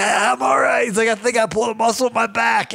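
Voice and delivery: high, strained pained voice. Retold or imitated. Friend with pulled back muscle, strained high reply. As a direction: push the words out through pain.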